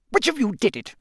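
high, sharp accusing question; snapping. A narrator's reading. Crouch taut with rage questioning the three, snapping. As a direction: snap at the suspects. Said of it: "he snapped".

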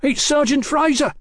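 very high-pitched cry of horror; frightened boy's high shout; horrified shout. A narrator's reading. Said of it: "shouted the dough-faced boy in horror".

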